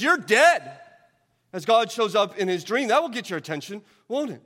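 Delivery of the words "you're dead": higher and louder mock gangster threat; God as a mock mob boss, punched louder. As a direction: spit it out like a mafia don. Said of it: "like a mob boss".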